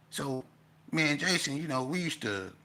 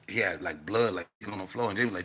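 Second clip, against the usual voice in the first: ordinary talk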